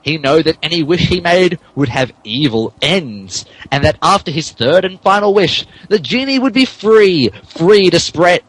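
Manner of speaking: ordinary delivery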